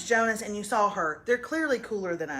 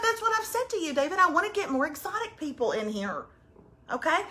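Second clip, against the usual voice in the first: ordinary talk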